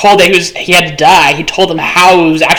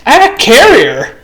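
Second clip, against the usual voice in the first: higher, delighted announcement; excited exclamation; 6 semitones higher than usual. Real, not acted. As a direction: proclaim it, thrilled with the find. Realizes he has a carrier.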